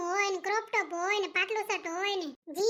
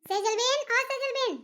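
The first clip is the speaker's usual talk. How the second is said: calling out; 4 semitones higher than usual. Acted. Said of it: "બાલ્કનીમાંથી રાડો પાડે છે".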